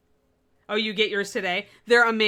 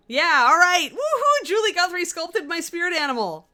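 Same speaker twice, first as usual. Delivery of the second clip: excited; 10 semitones higher, 8 dB louder than usual. Real, not acted. Thrilled over favourite model.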